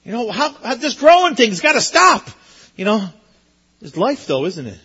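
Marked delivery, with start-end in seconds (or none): 0.0-2.3 high, amazed voice of a proud parent. Retold or imitated. Preacher imitating herself as a mom seeing grown kids, high and amazed. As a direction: marvel at how big the kids got, squeaky and amazed. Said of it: "and I'm like".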